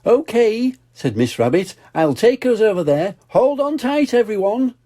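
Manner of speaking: high eager Miss Rabbit; cheerful high boatwoman voice; high cartoon rabbit voice; Miss Rabbit's voice. Miss Rabbit speaking in story.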